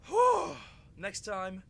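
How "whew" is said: loud relieved whew. Real, not acted. Relief after playing.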